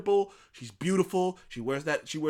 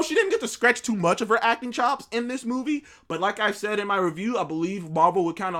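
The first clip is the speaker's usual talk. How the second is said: usual speaking voice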